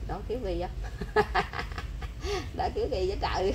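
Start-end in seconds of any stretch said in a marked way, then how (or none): 0.7-2.5 short unvoiced chuckle; soft amused laugh; soft breathy laugh. Real, not acted. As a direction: laugh quietly under the breath. Mother laughs at child's antics.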